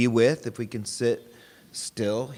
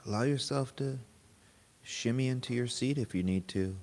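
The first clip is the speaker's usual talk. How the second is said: guided meditation; 3 semitones lower, 3 dB quieter than usual. Real, not acted. Teacher guiding a group to notice body and breath, guided meditation. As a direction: lead them gently inward, unhurried and even.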